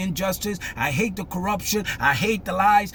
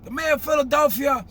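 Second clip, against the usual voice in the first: higher and louder mock insistence; insistent president; 8 semitones higher, 5 dB louder than usual. Imitating the president insisting on the mayor, louder. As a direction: talk right over her correction.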